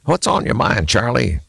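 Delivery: a grizzled local thinking aloud; gruff mechanic. Acted. Mechanic Levi talking to the axle, musing aloud.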